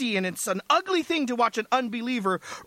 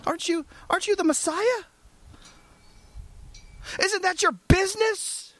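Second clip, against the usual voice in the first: pitched-up indignant sinner; demanding criminal; 12 semitones higher than usual. Retold or imitated. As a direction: voice the criminal's outrage. Criminal on cross demanding salvation.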